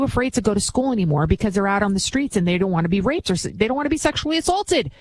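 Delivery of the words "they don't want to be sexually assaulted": raised pitch. Real, not acted. Stressing the danger to girls.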